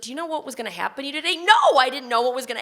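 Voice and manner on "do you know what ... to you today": very fast scolding question; nurse's question. Retold or imitated. Nurse scolding patient, very fast question. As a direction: rattle it off, scolding. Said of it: "She's like".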